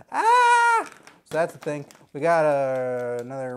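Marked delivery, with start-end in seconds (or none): 0.1-0.9 short high yelp of a burglar stepping on a nail; Daniel Stern scream. Retold or imitated. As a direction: yelp as if a nail pierced your foot. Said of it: "and then Daniel Stern goes".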